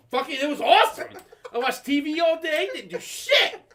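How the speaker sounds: high, loud excited boast; gleeful bragging. Retold or imitated. Kid telling friends about his week, gleeful bragging. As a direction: whoop it up to the homies.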